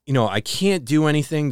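ordinary talk, nothing marked

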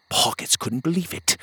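usual speaking voice

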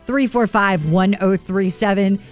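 usual speaking voice

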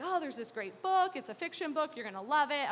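high, loud, eager book tip; enthusiastic librarian. Retold or imitated. Librarian eagerly recommending a book to a student, bubbly and upbeat. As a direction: gush about the book like a cheerful librarian.

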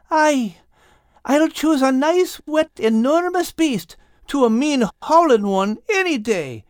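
very high-pitched, emphatic character voice; relieved Al. Acted. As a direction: declare your choice in a high, rattled voice. Al relieved aboard the whale.

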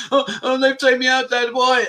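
high mock-wailing little boy; hammed-up sobbing complaint to Dad; imitated crying child. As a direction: whine it high like a tearful kid. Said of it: "he was crying his eyes out".

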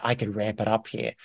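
soft, hushed thinking aloud; quiet realization. Retold or imitated. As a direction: murmur it to yourself as the idea dawns. Quoting own realization, first time.

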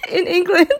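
put-on high English accent through giggles; mock English accent. Host greeting listeners in England, laughing English accent. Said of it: "my English accent".

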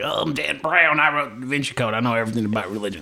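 cocky, fast know-it-all author; smug Dan Brown. Retold or imitated. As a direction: brag like a self-satisfied writer. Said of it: "he was like".

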